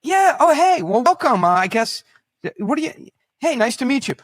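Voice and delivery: very high, loud gushing welcome; fake delight. Host suddenly gushing over his guest, loud and high. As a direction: gush over the guest you just recognized. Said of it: "I'm like".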